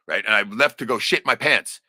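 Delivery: ordinary delivery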